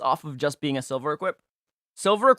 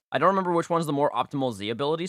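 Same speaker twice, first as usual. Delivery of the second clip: ordinary delivery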